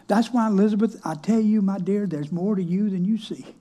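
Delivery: normal talking voice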